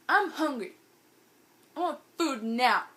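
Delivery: loud, whiny demand for food; put-on petulant demand; mock demanding. Hungry friend at the corn dog stand, mock demanding.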